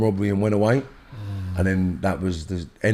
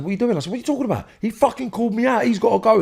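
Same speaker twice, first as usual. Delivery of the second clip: retold furious protest; 13 semitones higher, faster than usual. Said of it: "And I said".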